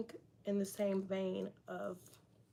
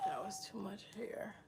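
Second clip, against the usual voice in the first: breathy whisper to herself; under her breath while styling hair; whispered aside; 8 dB quieter than usual. Real, not acted. Muttering to herself mid-styling.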